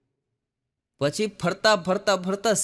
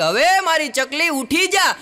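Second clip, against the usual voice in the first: calling out; 9 semitones higher, 4 dB louder, slower than usual. Retold or imitated. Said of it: "રાડ પાડી".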